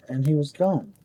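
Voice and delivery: ordinary delivery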